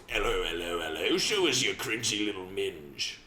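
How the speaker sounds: low, crude put-on British accent; mock a scary British bloke; rough-and-ready imitation British accent; real-life British accent. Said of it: "when in reality, it's like".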